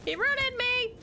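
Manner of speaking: building from high to very high panicked cries; rising panic. Real, not acted. Rooted in place, repeating, building.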